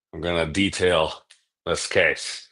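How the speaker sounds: ordinary delivery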